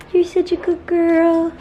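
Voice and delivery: high, slow pet voice. Real, not acted. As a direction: coo at the cat. Talking to her cat.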